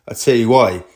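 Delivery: ordinary talk, nothing marked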